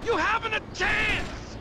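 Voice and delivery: boastful battle cry. Acted.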